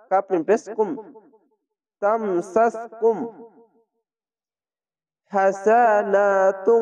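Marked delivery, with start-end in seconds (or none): 2.0-6.8 slow drawn-out তামসাসকুম হাসানাতুন with a rising end; recited slowly. Shown as a demonstration. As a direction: recite each syllable long and clear. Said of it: "teacher recites the joined phrase". (ধীরে টেনে তেলাওয়াত)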